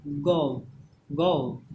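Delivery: गो with a falling "ou" glide; isolated, carefully rounded गो; model pronunciation. Shown as a demonstration. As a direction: say गो as "goh-oo" for students to repeat. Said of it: "जैसे पढ़िए".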